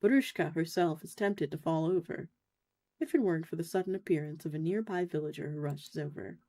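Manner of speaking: ordinary talk, nothing marked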